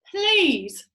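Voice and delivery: high, loud pained protest; offended plea. A narrator's reading. Roly-poly bird objecting to the glue talk, pained and loud. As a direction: stop him short.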